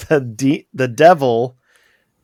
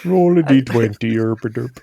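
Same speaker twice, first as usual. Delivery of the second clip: Swedish Chef voice. Retold or imitated. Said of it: "the Swedish chef voice".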